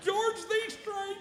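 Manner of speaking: high slow awestruck mumble; dazed fanboy. Retold or imitated. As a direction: starstruck babbling. Mocking his own fanboy babble.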